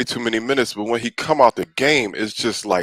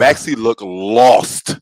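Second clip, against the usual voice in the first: drawn-out verdict; slow damning take on Maxey; slow, emphatic; much slower than usual. Real, not acted. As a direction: stretch each word to land it.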